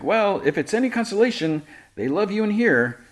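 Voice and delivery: high-pitched imitation of eager friend; consoling pal. Jimmy to tired Clark, consoling. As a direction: lift the voice high to cheer him up.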